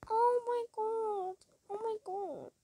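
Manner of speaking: soft, slow, fading mock sob; imitating crying Jenna. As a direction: lightly mimic her whimpering. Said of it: "Jenna's gonna be crying".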